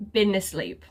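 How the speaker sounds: normal talking voice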